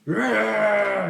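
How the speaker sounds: loud, high battle grunt "Rah!"; short combat roar; fighter's grunting roar. Acted. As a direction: grunt back fiercely at the challenger. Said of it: "He grunts something".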